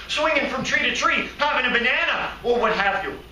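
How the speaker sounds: high-pitched, worked-up appeal; impassioned outburst. Acted. Man imagining every caged monkey's thoughts, impassioned outburst. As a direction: get carried away on the monkeys' behalf.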